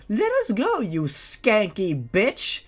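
put-on high, furious girl's yell. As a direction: spit the insult out slowly and hard. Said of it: "Jeannie yelled".

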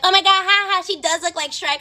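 high mock-giggly friends; imitated teasing. Friends joining the boys in laughing at Emily, mock teasing. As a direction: pitch it up, mimicking girls who pile on.

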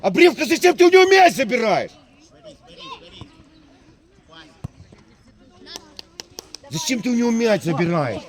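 loud, high scolding shout; angry shout. Real, not acted. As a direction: bellow the rebuke at the player. Coach scolding player loudly.

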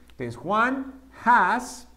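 Juan has, said slowly and clearly; careful teacher demo; slow model sentence. Shown as a demonstration. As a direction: spell it out slowly for the class. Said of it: "teacher models slow sentence".

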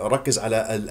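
usual speaking voice

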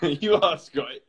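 slow teasing through laughter; laughing. Real, not acted. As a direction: laugh while insisting.

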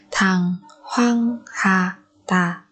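very slow "Thằng hoang hà tà"; syllable by syllable. Shown as a demonstration. Teacher modelling a word for learners, very slowly. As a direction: pause after each syllable of "Thằng hoang hà tà".

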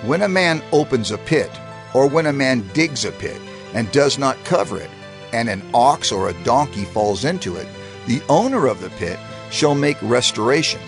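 plain reading voice

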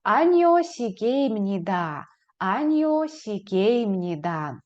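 Аніо сігеймніда slow and falling; slow model answer. Shown as a demonstration. Teacher drills the negative answer slowly. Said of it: "ви скажете".

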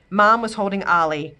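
ordinary delivery, nothing marked

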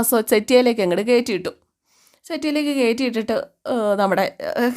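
usual speaking voice

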